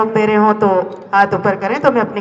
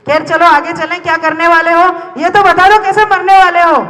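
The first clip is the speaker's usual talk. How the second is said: raised, higher-pitched dramatic questioning; heightened challenge; 7 semitones higher, 3 dB louder than usual. Acted. Demanding how they will die, high and insistent. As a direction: press the question in a high declaiming voice. Repeated line, raised for effect.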